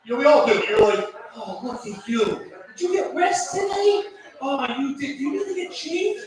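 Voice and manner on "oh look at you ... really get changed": put-on high sing-song voice used on a toddler; baby talk. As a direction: coo at an old person as if at an infant. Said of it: "talk to them like a baby".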